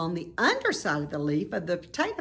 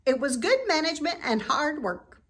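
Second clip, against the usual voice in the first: goose; 10 semitones higher, 3 dB louder, faster than usual. A narrator's reading. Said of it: "said the goose".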